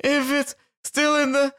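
high, mock-doting dad voice with a laugh; mocking Mark. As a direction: ham up the proud father of a fat baby. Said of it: "He's like".

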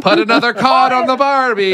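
loud, high, hammed-up Aussie-Newfie accent; mock Newfie-Australian accent. Joking along with the accent mash-up, mock Newfie-Australian accent. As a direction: belt out a mock Australian catchphrase in Newfie style.

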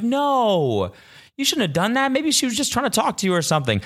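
high-pitched mock scolding; imitated protesting friend. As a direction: imitate a friend pleading the other side. Acts out friend scolding him.

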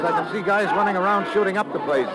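tense detective narration of the attack; urgent narration. A narrator's reading. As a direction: tell it like it's happening now. Narration of the night raid.

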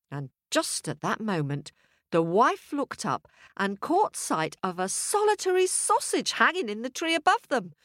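plain reading voice

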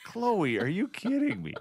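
high, mock exasperated scolding of the dog. Owner scolding his dog Chloe, high and exasperated. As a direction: squeak it out at the dog in disbelief. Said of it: "and I'm like".

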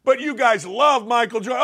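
normal talking voice

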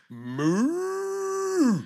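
long moo sliding way up high; hammed-up rising cow call. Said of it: "I was waiting for a moo".

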